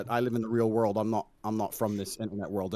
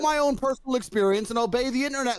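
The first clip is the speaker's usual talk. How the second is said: much higher, mocking sing-song sarcasm; sarcastic mockery; 11 semitones higher than usual. Mocking opponent's internet study argument, sarcastic high voice. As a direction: sneer at the idea with high mock voice.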